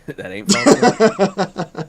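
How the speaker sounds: put-on slow, high Italian accent on Falco; mock Italian accent. Friend repeats the line as an Italian. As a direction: stretch it out like a cartoon Italian. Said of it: "you made him Italian".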